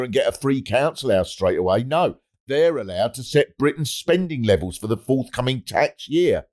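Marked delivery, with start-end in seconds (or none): none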